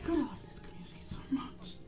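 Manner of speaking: soft whisper to herself; a hushed, fond aside. Real, not acted. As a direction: breathe it out in a whisper.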